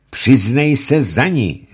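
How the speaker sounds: high, loud, slow stern command; stern judge. Acted. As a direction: order him to confess. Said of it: "řekl rychtář Ulenšpíglovi".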